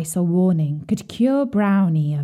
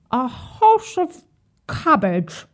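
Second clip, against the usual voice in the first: mouth full; 6 semitones higher, slower than usual. A narrator's reading. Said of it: "answered Whitey with a mouthful".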